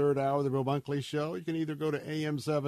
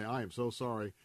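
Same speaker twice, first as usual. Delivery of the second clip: ordinary talk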